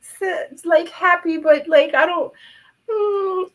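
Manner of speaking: high, voice catching and breaking with emotion; fighting back happy tears mid-sentence; choked up. Real, not acted. Streamer touched by her chat, voice high and breaking. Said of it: "Oh, I'm getting emotional".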